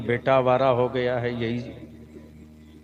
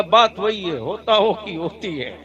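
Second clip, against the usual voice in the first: put-on Bengali accent in Hindi; 4 semitones higher than usual. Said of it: "बंगाली दादा".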